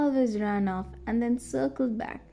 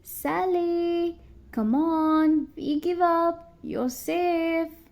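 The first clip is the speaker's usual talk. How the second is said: high searching call; 7 semitones higher, much slower than usual. A narrator's reading. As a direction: call across the woods to coax her out. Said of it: "they called out".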